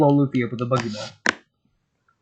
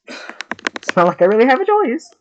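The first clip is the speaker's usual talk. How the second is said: put-on high exasperated girl; 13 semitones higher, 9 dB louder than usual. Nami sighs, voiced character.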